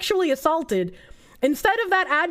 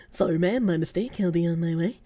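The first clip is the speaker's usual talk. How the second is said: mock apologetic predator; 9 semitones lower than usual. Mock predator replies politely.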